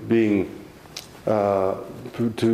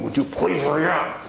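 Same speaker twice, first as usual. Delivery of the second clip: impatient plea; 8 semitones higher than usual. Retold or imitated. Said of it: "I'd be going".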